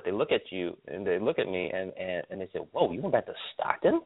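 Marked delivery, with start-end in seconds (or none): 2.7-4.0 mock disbelief. People's reaction to moving back.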